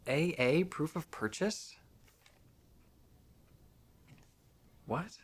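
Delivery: high, incredulous question; can't believe what it says; rising disbelief; baffled. Real, not acted. Baffled joke.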